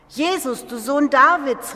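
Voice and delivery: high calling cry to a passing crowd. Retold or imitated. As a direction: cry out to be heard over the throng. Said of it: "rief ich".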